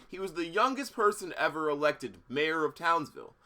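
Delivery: usual speaking voice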